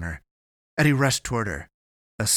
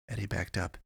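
ordinary delivery

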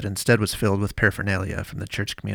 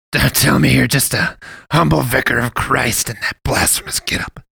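put-on louder, higher scornful old man; mocking fat priest; 4 semitones higher, 7 dB louder than usual. A narrator's reading. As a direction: sneer at her costume.

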